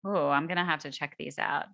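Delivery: ordinary delivery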